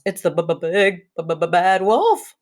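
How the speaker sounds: slow, stuttering, chattering fright; frightened pig stutters out the name; teeth chattering. A narrator's reading. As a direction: stammer through chattering teeth. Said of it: "Boris chattered".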